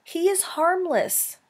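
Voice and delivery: high, insistent plea; begging. Acted. Mother pleading that her son be spared, begging. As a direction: plead with the pitch lifted high. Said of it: "begged Roz".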